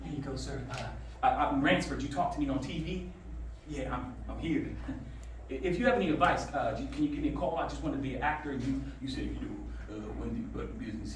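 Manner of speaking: soft, hesitant nervous voice; mumbling to the actor he admires, shaky with nerves; shy nervous mumble. Retold or imitated. Fan meeting his idol on the street, speaking softly and nervously. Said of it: "how nervous my voice was".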